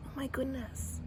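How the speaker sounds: breathy whispered Oh my goodness; hushed mock gasp; whispered exclamation. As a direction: whisper it under your breath. Imitating her new phrase.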